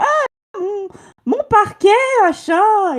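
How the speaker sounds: high-pitched mock maternal wail; fussing mother. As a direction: wail like a mother over her floor. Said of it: "il y a sa mère qui doit lui dire".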